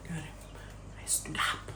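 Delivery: slow, soft whisper. Real, not acted. Recalling standing up nervously, slow whisper. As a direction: breathe it out slowly, barely voiced.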